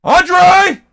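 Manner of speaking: loud high shout across the room; calling a name at full voice. Real, not acted. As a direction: yell the name to reach him. Shouting for someone offstage.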